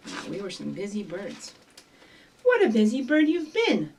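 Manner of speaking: usual speaking voice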